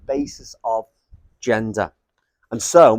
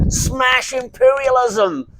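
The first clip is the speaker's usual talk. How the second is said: high, drawn-out mock American sloganeering; American Spart accent; 11 semitones higher, much slower than usual. Lecturer parodying tankie slogans, high mock American accent. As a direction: proclaim it slowly in a put-on American accent. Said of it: "doing the American Spart accent".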